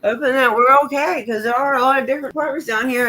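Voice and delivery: usual speaking voice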